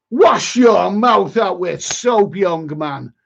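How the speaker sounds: drawn-out, hammed-up telling-off; mock scolding. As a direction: slowly scold like a parent, in mock outrage. Mock scolding of a viewer's question.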